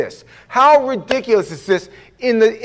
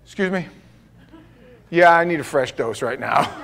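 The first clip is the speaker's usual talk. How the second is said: normal talking voice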